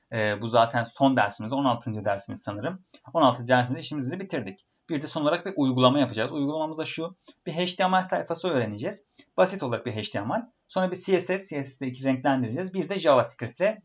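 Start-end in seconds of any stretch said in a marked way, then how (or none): none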